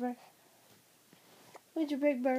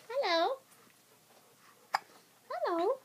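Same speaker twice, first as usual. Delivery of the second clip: high cooing hello; 7 semitones higher than usual. Real, not acted. Cooing at baby.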